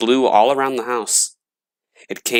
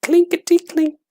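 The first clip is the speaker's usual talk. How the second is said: very high, tinny clinking voice; coins rattling; 3 dB louder than usual. A narrator's reading. Coins rattling in the gravedigger's tin box, high clinking voice. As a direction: ring it out like tiny silver coins. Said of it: "the coins going clinkety-clink".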